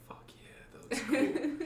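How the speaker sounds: hushed whisper to himself; whispered self-admiration. Retold or imitated. Gym-goer admiring his pumped-up body in the mirror, whispered. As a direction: whisper it, privately impressed with yourself.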